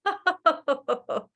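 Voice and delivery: high laugh falling away; released laugh. Shown as a demonstration. Teacher laughing out the held breath, joyful. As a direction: let a full laugh go. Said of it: "And let it go".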